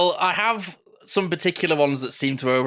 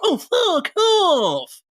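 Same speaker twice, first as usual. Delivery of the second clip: very high, slow, loud comedian mimicry; stretched, over-the-top comic impression; Lee Evans; 5 dB louder, much slower than usual. Shown as a demonstration. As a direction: draw it out in an exaggerated pitched-up cry. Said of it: "even Lee Evans as well".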